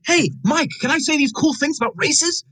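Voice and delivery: high, mock-dumb voice; a hammed-up clueless actor asking permission; Mark Wahlberg impression. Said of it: "Mark Wahlberg probably just said".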